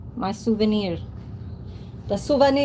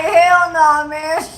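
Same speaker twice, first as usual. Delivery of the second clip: mock loud refusal; 6 semitones higher, 7 dB louder, much slower than usual. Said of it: "I'd be like".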